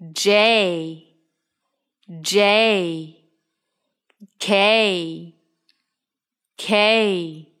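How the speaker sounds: each letter drawn out slowly and clearly; over enunciating. Shown as a demonstration. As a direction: spell the alphabet slowly, stretching every letter. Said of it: "请跟着我慢慢地一起读一遍".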